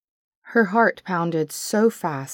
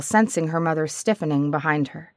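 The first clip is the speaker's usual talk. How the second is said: ordinary delivery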